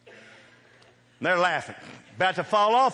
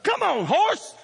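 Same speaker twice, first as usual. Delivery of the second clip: high, loud yell urging the horse on; shrill-high cowboy holler; 14 semitones higher, 6 dB louder, slower than usual. Retold or imitated.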